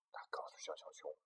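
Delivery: soft unvoiced whisper. A narrator's reading. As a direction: breathe the words. Whispered narration.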